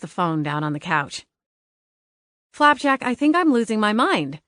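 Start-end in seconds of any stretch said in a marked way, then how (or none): 2.5-4.4 exasperated. Acted. Exasperated plea to the cat.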